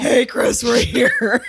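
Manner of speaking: high, giddy mock call to the band; mock excited fan greeting. Podcast host imagines greeting the singer backstage, mock excited. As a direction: playfully ham up two moms arriving backstage.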